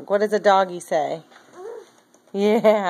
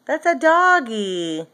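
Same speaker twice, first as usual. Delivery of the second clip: slow, clear correction; 3 semitones higher, slower than usual. Real, not acted. Parent repeats it slowly to toddler.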